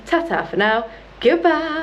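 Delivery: bright high goodbye; cheery sign-off. Real, not acted. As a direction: sing out the farewell.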